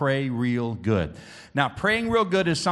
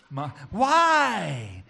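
drawn-out, higher comic whine; mock tearful wail; 4 semitones higher, 4 dB louder than usual. Son baffled by his own crying, mock tearful wail. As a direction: wail it as if bursting into tears. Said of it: "I just start crying".

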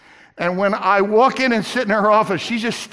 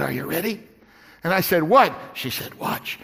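much softer, lower, conspiratorial; hushed; 8 semitones lower, 6 dB quieter than usual. Retold or imitated. Banker tells preacher quietly to watch. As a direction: lean in and say it quietly.

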